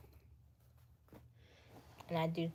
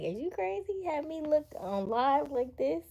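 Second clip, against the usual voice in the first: a high, shocked "are you crazy" from an imagined woman; mock outrage; 10 semitones higher, 7 dB louder than usual. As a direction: playfully mimic an appalled woman. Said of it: "They would be like".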